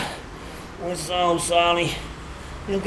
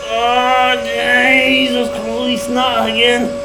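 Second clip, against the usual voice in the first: loud, slow, drawn-out wail; dismayed panic; 3 semitones higher, 4 dB louder, much slower than usual. Acted. Man grabbed once more cries out, panicked. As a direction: wail as it starts over. Seized and lifted again.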